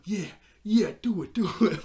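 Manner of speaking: high, loud imitation of delighted cheering; gleeful egging-on. Glimmer cheering on a fight with Adora, gleefully. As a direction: egg them on, bursting with mock glee. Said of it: "Glimmer looks so happy".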